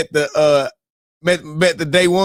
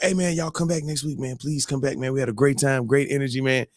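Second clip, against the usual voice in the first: quietly voicing the hosts; a hushed friendly plea; soft mock invitation; 5 semitones lower, 6 dB quieter than usual. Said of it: "they like".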